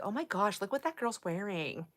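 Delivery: put-on a hushed mean-girl aside; catty aside. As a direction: mutter a catty remark about a stranger. Retold catty remark about girl.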